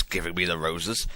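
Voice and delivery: ordinary delivery, nothing marked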